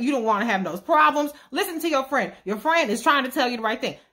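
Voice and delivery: ordinary talk, nothing marked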